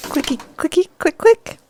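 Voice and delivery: put-on high, singsong baby talk. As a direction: coo it up high like to a pet. Offering the mouse, baby talk.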